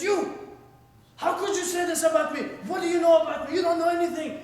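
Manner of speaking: high, loud accusing outburst; acted angry yell. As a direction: shout it as an indignant confrontation. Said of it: "he's yelling and yelling".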